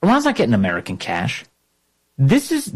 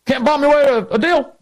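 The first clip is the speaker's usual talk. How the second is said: high, sing-song mock quote; mocking Obama; 12 semitones higher than usual. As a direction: toss it off in a higher, mocking tone. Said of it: "Obama was completely out of control".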